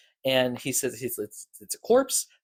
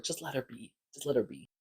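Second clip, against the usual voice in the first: hushed; 10 dB quieter, faster than usual. Retold or imitated. Alice calming Sam about Gwen.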